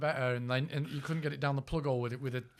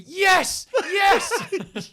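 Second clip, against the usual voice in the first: high, loud cries of delight; ecstatic yell; 12 dB louder than usual. Pretend. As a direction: shout it out in ecstasy. Joke about the astronauts' fun.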